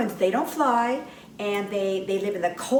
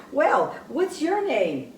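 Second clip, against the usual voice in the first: ordinary talk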